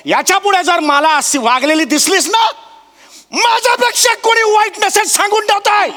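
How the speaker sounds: high, angry shouted threats; furious, high-pitched tirade. Acted. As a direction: rant at full pitch, ordering someone out. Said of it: "मला असा आवाज चढवायचा नव्हता".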